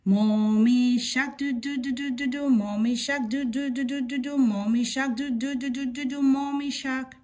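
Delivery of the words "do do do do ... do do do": usual speaking voice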